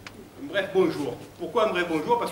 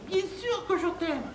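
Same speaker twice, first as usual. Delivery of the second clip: high, slow, swooning falsetto; bimbo in love; 12 semitones higher, slower than usual. Acted. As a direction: declare love in a girlish high voice. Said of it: "Et moi, j'ai dit". (voix aiguë de bimbo amoureuse)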